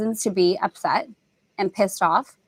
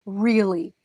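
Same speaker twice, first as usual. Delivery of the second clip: ordinary talk, nothing marked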